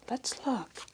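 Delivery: soft hushed invite; whispering. A narrator's reading. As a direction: whisper it to the kids. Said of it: "reader invites children to peek".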